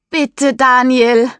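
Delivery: slow, loud and high, calling into the dark; loud pleading call. Acted. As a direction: plead across the water. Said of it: "ruft sie jetzt laut in die Nacht". (laut flehend rufen)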